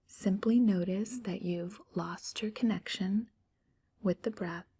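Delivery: ordinary delivery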